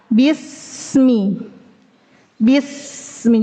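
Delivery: BISMI with a long, sustained whistling s; flowing sin. Shown as a demonstration. Teacher demonstrating rokhowah, long flowing s. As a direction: let the s of BISMI flow before "mi". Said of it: "Mengalirnya suara".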